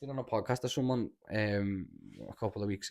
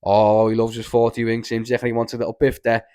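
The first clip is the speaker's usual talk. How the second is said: teasing; 12 dB louder than usual. Retold or imitated. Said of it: "I'd say".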